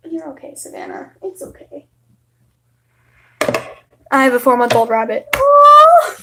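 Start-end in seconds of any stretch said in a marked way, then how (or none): 0.1-1.9 barely-voiced hush to the cat; near-whispered comfort; soft soothing whisper. Real, not acted. 5.3-6.2 high, loud squeal of delight; excited aww. Real, not acted. Reacting to pet rabbit.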